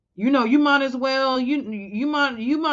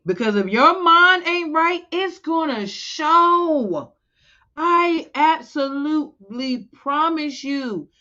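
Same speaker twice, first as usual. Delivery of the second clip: higher, louder, drawn-out warning; rising preacher's insistence; emphatic warning; 7 semitones higher, 3 dB louder, slower than usual. Real, not acted. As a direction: press the point home hard.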